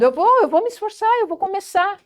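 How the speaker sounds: agudo e ligeiro, pronto para começar; animado, determinado. Shown as a demonstration. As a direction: mostre disposição de quem vai tentar. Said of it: "Olha a diferença". (com ânimo e iniciativa)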